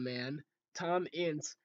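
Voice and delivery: ordinary talk, nothing marked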